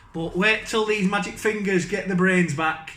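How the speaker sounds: usual speaking voice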